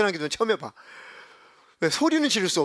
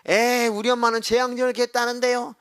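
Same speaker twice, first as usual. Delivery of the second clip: put-on slightly higher, lightly whiny kid's excuse; mocking students; 6 semitones higher than usual. Pastor mimicking students' excuse, lightly whiny. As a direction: parrot the kids' excuse.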